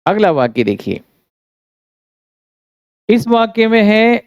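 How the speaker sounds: ordinary delivery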